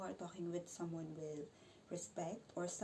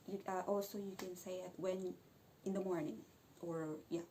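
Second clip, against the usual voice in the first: ordinary delivery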